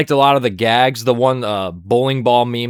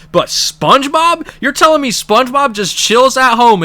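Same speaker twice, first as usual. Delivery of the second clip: incredulous; 10 semitones higher, 5 dB louder than usual. Real, not acted. Vlogger can't believe SpongeBob made the joke, incredulous. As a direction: stretch it out in disbelief.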